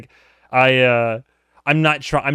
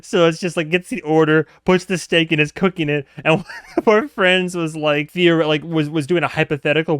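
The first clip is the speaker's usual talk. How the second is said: ordinary talk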